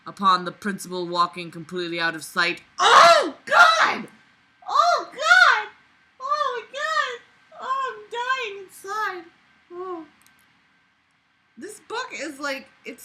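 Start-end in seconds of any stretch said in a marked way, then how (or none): 2.8-5.7 high, loud wailing oh god; anguished shriek. Real, not acted. Reader shrieks in agony at the terrible book. 6.2-10.1 high, slow whimpering wail; dying inside, whine it out; mock wailing. Real, not acted. Reader wails in mock pain over the bad book.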